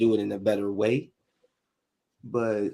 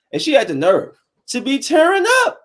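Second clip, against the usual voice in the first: incredulous outburst; 7 dB louder than usual. Real, not acted. Retelling daughter crying, mock outrage.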